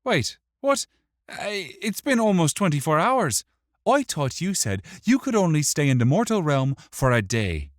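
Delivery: high, loud, startled protest; indignant Wick. Acted. Wick challenges the imp's claim, startled and indignant.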